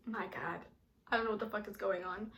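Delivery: soft, low mutter; overwhelmed aside. Retold or imitated. Girl stuck at date's house, quietly overwhelmed. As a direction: mutter it to yourself, lost.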